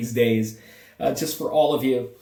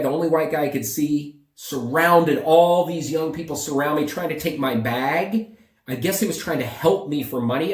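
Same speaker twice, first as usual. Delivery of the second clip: ordinary talk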